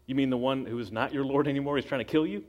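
normal talking voice

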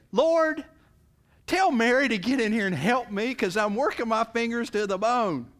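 loud, high, fed-up whine; exasperated complaint. Acted. Martha complaining to Jesus about Mary, loud and exasperated. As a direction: complain loudly to the Lord.

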